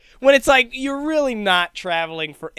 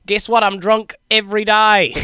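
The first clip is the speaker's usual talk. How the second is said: louder cocky Aussie voice; Australian accent; 4 semitones higher, 9 dB louder than usual. Acted. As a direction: brag in a New Zealand-Aussie accent. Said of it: "mine shifts between".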